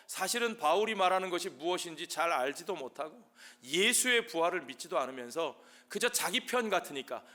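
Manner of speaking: ordinary talk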